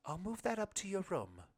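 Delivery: wife offering to carry the suitcase; wife's voice. A narrator's reading.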